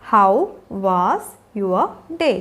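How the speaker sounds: slow, spaced-out words. Shown as a demonstration. Teacher showing learners how to greet children after school, word by word. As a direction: leave a gap after each word. Teacher models question slowly, then joined.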